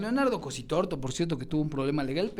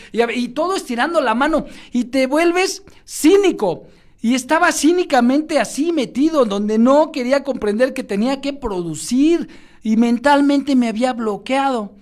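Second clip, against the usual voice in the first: higher and louder, driving; fervent preaching; 6 semitones higher, 8 dB louder than usual. Real, not acted. Speaker confesses his cynicism, loud and fervent. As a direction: pound it out like a sermon peak. Self-critical preaching build.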